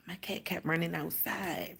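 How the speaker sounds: breathy unvoiced whisper, hushed. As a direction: tell it under your breath, no voice. Retelling worried night, whispered.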